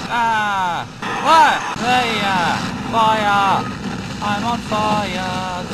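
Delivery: slow, stretched I'm on fire; drawn-out cry. Real, not acted. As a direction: wail it out slowly.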